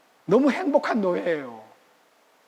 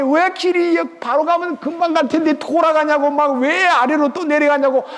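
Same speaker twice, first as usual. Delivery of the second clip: higher, whiny grumbling at God; mock the complaining people; grumbling complaint; 8 semitones higher, 4 dB louder than usual. Israelites grumbling at God about the long road, whiny. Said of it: "막 짜증내가지고".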